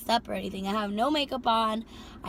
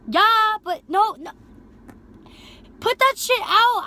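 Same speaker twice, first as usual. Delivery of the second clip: much higher, louder, slow and firm; angry order; 11 semitones higher, 10 dB louder than usual. Retold or imitated. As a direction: stamp it out with your voice. Ordering him to stop smoking.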